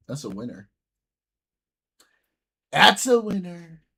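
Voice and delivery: ordinary delivery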